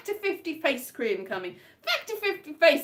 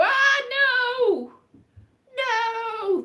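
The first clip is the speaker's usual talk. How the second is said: high, loud hammed-up cry of no; mock childish scream; 11 semitones higher, 6 dB louder, much slower than usual. Kid watching a scary film, mock scream. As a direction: wail like a scared kid at the TV. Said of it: "when you're a kid and you go".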